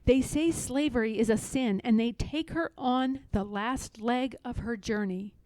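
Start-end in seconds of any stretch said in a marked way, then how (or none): none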